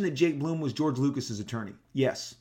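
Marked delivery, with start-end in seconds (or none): none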